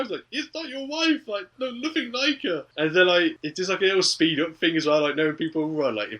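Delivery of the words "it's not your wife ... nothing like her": high, comic protesting voice; pitched-up retold objection; mock protest. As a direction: act out an exasperated denial. Acted protest to angry husband.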